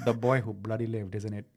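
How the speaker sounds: low, soft mock Indian accent; comic Indian accent. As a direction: murmur it in a put-on Indian accent. Riffing accented Harry Potter lines.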